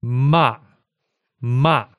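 MA falling sharply, a little angry; emphatic falling "ma"; fourth tone. Shown as a demonstration. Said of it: "ma in fourth tone".

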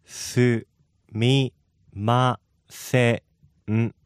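each syllable said apart. Shown as a demonstration. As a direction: break it into syllables. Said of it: "break that down by the syllables".

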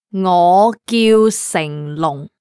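ordinary talk

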